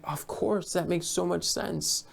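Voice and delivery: suddenly getting it, hushed and eager; excited realization. Retold or imitated. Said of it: "But she was so excited".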